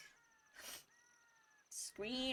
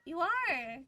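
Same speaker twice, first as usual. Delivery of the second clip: higher, tender reassurance to the cat; reassuring; 7 semitones higher, 4 dB louder than usual. Real, not acted. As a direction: soothe the upset cat gently.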